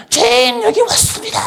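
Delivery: very high, keening mock cry to the Lord; mock wailing prayer. Preacher mimics people crying out in prayer, high wail. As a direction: wail it upward like a sobbing penitent. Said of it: "막 울더라고".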